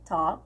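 "talk." said tok with no L; silent L. Shown as a demonstration. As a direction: drop the L in "talk.". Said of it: "não fala o L também".

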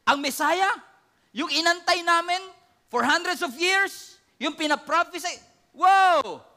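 high, loud mock outrage; the Sanhedrin shocked by Jesus's claim; astonished crowd. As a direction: gasp it out in disbelief.

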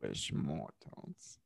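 very deep, slow theatrical villain menace; Ghost Host voice. Retold or imitated. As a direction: drag it out low and ominous like the ride's host. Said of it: "it's the ghost host".

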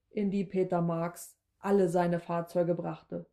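usual speaking voice

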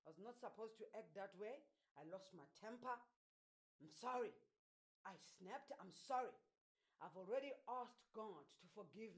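soft, low, subdued apology; gentle apology. Acted. Preacher models a parent apologizing to their child, gentle apology. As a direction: say sorry quietly and sincerely.

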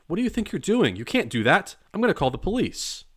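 high, loud, outraged yelling; outraged shouting. A narrator's reading. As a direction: shout at them in alarm. Groundskeeper outraged, threatening.

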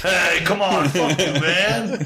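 high pitched rowdy orc, slowed on fuck you; rowdy orc bro. Acted. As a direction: play the boisterous orc breaking up a fight. GM voicing orc calming fight.

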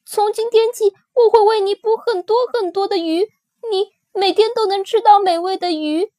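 put-on high little child voice, begging; a small creature bargaining, scared; pleading baby dinosaur. A narrator's reading. Baby spinosaurus pleads for life. (高音小孩声，害怕地哀求)

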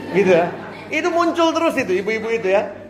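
high, hammed-up gossip voice; gossipy soap opera auntie. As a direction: play the meddling auntie from a soap. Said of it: "Ini kalau kayak sinetron".

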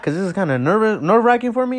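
real flustered stutter; nervous stuttering. Real, not acted. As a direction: trip over the word from nerves. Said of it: "I'm stuttering a lot".

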